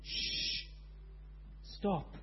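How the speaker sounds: soft shush, then a quiet stop; calm the wind with a hush; gentle storyteller shush. A narrator's reading. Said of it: "he said to the wind".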